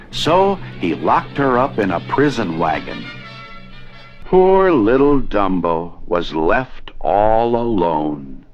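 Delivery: ordinary delivery, nothing marked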